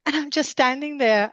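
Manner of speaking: ordinary talk, nothing marked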